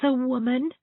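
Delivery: put-on high, small child's voice, wistful; a lonely little girl confiding; little girl's voice. Elizabeth telling Miss Shirley her father can't bear her, small and wistful.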